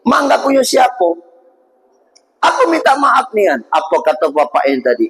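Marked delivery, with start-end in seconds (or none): 0.0-3.6 suara tinggi memelas, memohon-mohon. Acted. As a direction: merengek minta dimaafkan. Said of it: "Dia nyembah-nyembah kalau bahasa kita". (pemuda menyembah-nyembah minta diikhlaskan)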